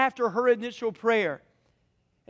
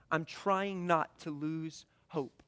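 weary quiet prayer; 6 semitones lower, 7 dB quieter, slower than usual. Acted.

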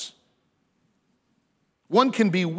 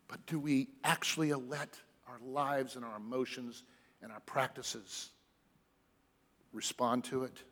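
soft, low, prayerful confession; hushed prayer; 6 semitones lower, 13 dB quieter than usual. Acted. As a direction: pray it quietly and humbly.

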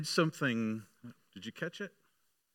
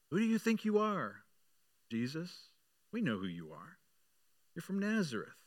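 mocking sneer, pitched much higher to start; scornful hometown crowd; 8 semitones higher than usual. Hometown people sneering at Jesus, mocking. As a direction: jeer at him like the neighbours who knew him.

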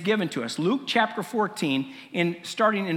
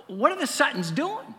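high-pitched, puzzled question; mock bewilderment, pitched well up; baffled neighbors; 8 semitones higher than usual. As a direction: imitate nosy neighbors wondering aloud.

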